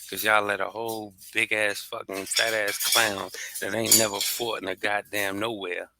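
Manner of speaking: usual speaking voice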